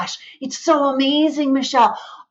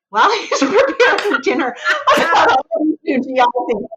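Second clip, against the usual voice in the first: laughing through the words, pitch up; 5 semitones higher, 4 dB louder than usual. Real, not acted. Podcast guest telling host about husband, laughing. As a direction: giggle while telling about dinner talk.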